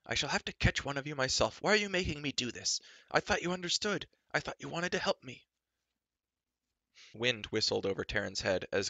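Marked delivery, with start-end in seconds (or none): 0.0-5.5 high, whiny giant pleading; a sulky giant's high whine; whining. Acted. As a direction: whine like a hurt overgrown child. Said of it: "wailed Glue".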